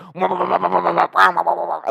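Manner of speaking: high revving engine sound made with the mouth; engine revving noise. Retold or imitated. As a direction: mimic a minivan redlining. Imitating the minivan engine redlining.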